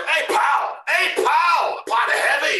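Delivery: shouted, high Scottish pub call; Scottish accent. Shown as a demonstration. Guest shows off his one Scottish accent, shouted and hammed-up. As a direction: holler it loud in a rough-and-ready Scottish impression. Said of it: "I mean, the Scottish".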